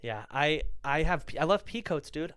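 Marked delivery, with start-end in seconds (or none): none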